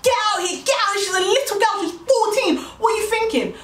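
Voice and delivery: high-pitched, re-enacted furious tirade; heated high rant, phrase after phrase; furious confrontation. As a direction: play the cousin yelling him away from the door. Cousin confronts him at the door.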